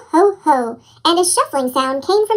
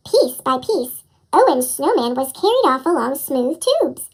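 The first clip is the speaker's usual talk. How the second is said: usual speaking voice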